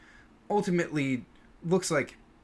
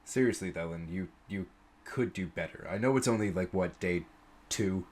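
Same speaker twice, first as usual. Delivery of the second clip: low, softer mocking scold; gruff old warrior teasing; 6 semitones lower, 5 dB quieter than usual. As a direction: needle the young guildmaster in a deep rumble. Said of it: "and goes".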